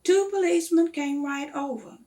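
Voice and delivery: usual speaking voice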